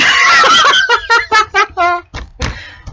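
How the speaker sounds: loud high burst of real laughter; real loud laugh after telling the joke. Real, not acted. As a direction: crack up loudly at the punchline.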